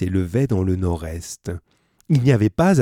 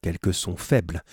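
usual speaking voice

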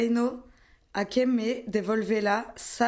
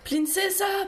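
high ringing call; 9 semitones higher than usual. Acted. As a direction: call her name into the woods. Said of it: "chamando pela princesa".

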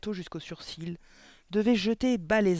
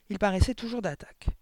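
plain reading voice

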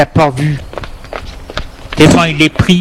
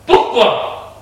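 high, abrupt angry question; furious; 8 semitones higher than usual. A narrator's reading. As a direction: demand an answer in anger. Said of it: "Il lui demande furieux". (question furieuse, plus aiguë)

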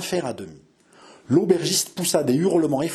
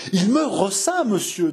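majestic indignation; 6 semitones higher than usual. A narrator's reading. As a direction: puff up with wounded pride. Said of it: "avec une majestueuse indignation".

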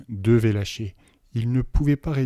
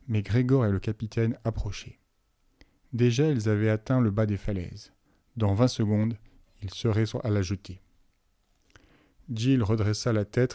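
ordinary delivery, nothing marked

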